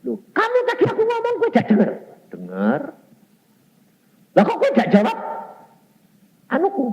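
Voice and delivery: high, loud scolding father; angry father. Retold or imitated. Father glaring and scolding his son, high and loud. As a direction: snap at the son in disbelief. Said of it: "Langsung melotot".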